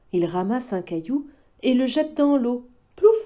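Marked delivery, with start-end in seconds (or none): none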